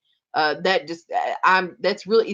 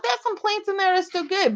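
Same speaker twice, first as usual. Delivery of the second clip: pleading; 13 semitones higher than usual. Retold or imitated. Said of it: "And I'm like".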